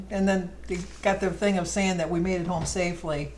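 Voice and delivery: normal talking voice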